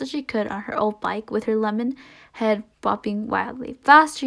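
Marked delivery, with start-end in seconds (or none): 3.8-4.3 high, loud cheering yell. Acted. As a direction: yell it to urge her on. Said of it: "yelled Suzanne".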